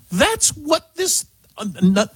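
high, slow disbelief; amazed emphasis. Real, not acted. Host marveling at what an AI agent said, high and amazed.

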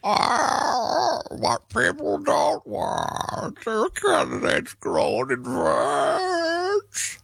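high, drawn-out wail of a politician; mocking candidate impression. Host parodying the candidate they just played, mocking drawl. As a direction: stretch each phrase out, high and strained.

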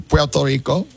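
"Puerto Rico" said the Spanish way; Spanish pronunciation. Shown as a demonstration. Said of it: "Why are you saying it like that?".

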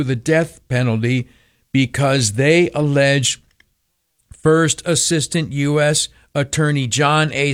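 host reading a newspaper article to listeners on air; news article read. Acted. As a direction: read the article like a newscaster, measured. Reads newspaper article aloud.

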